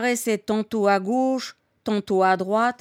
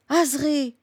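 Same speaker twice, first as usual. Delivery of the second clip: higher-pitched call of her name; alarmed cry; 6 semitones higher than usual. A narrator's reading. Fighter seeing the old woman fall, alarmed cry.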